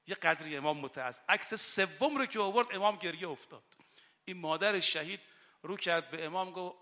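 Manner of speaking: loud, impassioned rowza sermon; rising impassioned sermon voice; fiery preaching. Acted. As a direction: preach it out with full fervour. Impassioned preaching about duty.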